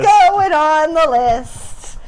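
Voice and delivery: slow, loud, drawn out intoning; slow dramatic drawl. Pretend. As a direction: stretch each word out dramatically. Repeating the running joke.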